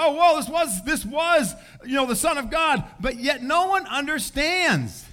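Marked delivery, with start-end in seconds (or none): 0.0-2.8 stunned, it dawns on them; awed realization. Retold or imitated. Onlookers at the cross realizing, amazed. Crowd realizing Jesus was God.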